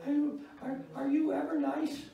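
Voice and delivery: put-on high nervous boy voice; scared child voice. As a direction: squeak it out like a frightened kid. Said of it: "He said".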